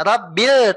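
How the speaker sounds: Rabbil dengan b ditekan seperti tasydid; pressed bil. Shown as a demonstration. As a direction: hold the doubled b in Rabbil. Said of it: "tekan dulu". (Rabbil dengan bil ditekan)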